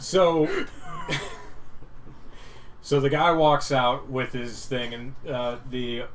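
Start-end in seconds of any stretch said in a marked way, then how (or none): 0.5-2.8 airy high wheeze of laughter; breathless laugh. Real, not acted.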